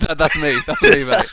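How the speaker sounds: laughing loudly. Real, not acted. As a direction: crack up while admitting it. Laughing admission.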